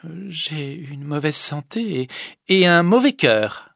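slow, hard-edged reply. Acted. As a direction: spit out the verdict slowly and harshly. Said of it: "d'un ton dur". (ton dur et lent)

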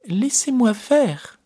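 put-on high, slow old lady's voice; old woman voice. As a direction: keep up the disguise. Disguised Raoul, old woman voice.

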